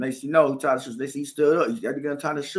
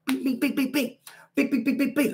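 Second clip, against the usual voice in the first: higher beep punch noises; punch sounds; 11 semitones higher than usual. Retold or imitated. Fighting the Mexican again, punch noises. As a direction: rattle off punches.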